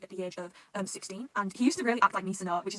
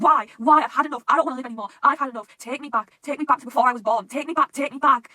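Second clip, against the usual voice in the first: louder, raised pleading outburst; imitated breakdown; 4 semitones higher, 12 dB louder than usual. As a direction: act out breaking down, begging loudly. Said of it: "he just starts breaking down".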